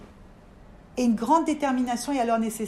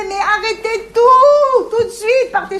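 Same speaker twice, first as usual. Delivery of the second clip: loud, high, slow cry to the world; urgent loud plea, much higher; calling out to everyone; 9 semitones higher, 11 dB louder than usual. Retold or imitated. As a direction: shout it out to the whole world. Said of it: "t'as envie de dire au monde entier".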